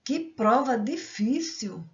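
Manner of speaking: "Que prova difícil!" with slow, drawn-out vowels; slightly prolonged exclamation. Shown as a demonstration. Teacher demonstrating exclamative sentences to students, "Que prova difícil!" slightly prolonged. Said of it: "apresenta uma entoação de voz ligeiramente prolongada".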